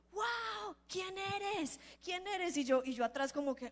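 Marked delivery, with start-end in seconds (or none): 0.1-2.6 high-pitched amazed outburst, retold; astonished. Preacher voices the taxi driver reacting to her prophecy, astonished. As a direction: imitate a man stunned by what he heard.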